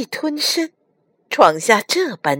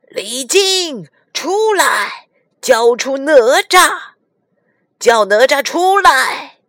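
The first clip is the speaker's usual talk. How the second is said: loud, raised dragon-king demand; clamouring threat; 5 semitones higher, 5 dB louder than usual. A narrator's reading. Dragon kings demand Li Jing hand over Nezha, clamouring loudly. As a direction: call him out with menacing force. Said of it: "龙王们叫嚣着".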